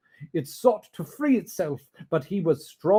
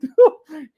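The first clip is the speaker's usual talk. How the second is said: high, tearful whoop of a laugh; joyful laugh; 11 semitones higher, 13 dB louder than usual. Acted. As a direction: burst into a laughing cry. Scrooge laugh.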